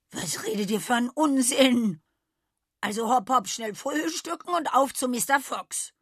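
high, loud, impatient goat; bossy goat. A narrator's reading. Goat hurries the grumbling animals along, impatient. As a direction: shoo them along briskly.

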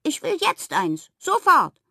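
play-acted squealed demand, building; demanding toy pig. A narrator's reading. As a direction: insist like an impatient little piglet.

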